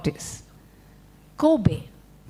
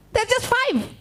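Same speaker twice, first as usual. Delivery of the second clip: proud duck; 10 semitones higher than usual. Acted.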